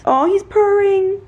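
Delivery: high, slow delighted coo. Real, not acted. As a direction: coo slowly over a purring kitten. Cooing to purring cat.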